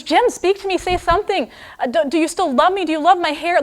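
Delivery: high, loud, anxious plea to her husband; wife pleading with her husband, high and loud. Acted. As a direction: beg him to say something. Della pleads with silent Jim.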